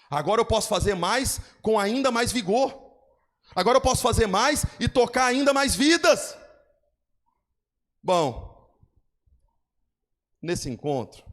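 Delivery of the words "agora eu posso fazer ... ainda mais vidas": building preacher cadence. Acted. Pastor building to climax.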